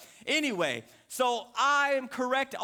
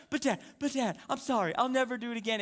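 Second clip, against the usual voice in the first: high-pitched mock pleading of a little girl; imitating crying child; 4 semitones higher, faster than usual. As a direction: beg like a panicking kid. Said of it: "she's crying and freaking out".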